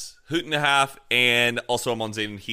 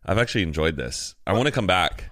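ordinary delivery

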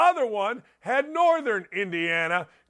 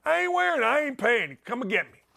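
mock-combative Northern Indiana tough talk; Northern Indiana accent; faster than usual. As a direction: argue back like a Gary local, in Northern Indiana accent. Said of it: "Northern Indiana, we go these, them, and those".

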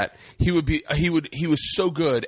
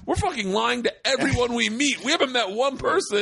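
higher-pitched mock exasperation; worked-up retelling of a confrontation; retold outburst; 9 semitones higher than usual. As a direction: call out your friends, pitched up. Confronting friends in retold quote.

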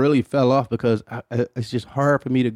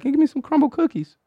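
much higher-pitched voice for a daughter; imitated daughter; 13 semitones higher than usual. Daughter asking dad for cookies, imitated high-pitched request.

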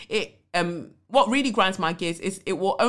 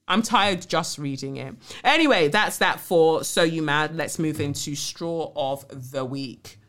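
ordinary delivery

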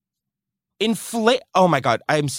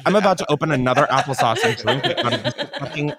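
ordinary talk

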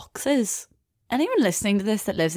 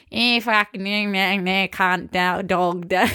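garbled Australian lads' babble; mangled Aussie accent, words smeared together; mock Australian accent; slower than usual. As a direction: imitate Australian boys as indistinct whiny noise. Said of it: "a group of Australian boys walking along".

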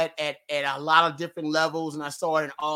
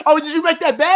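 high and loud, still laughing; laughing question; 10 semitones higher, 10 dB louder than usual. Real, not acted. Guest asks the host about his bet, laughing loudly. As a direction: ask it through the laugh, delighted.

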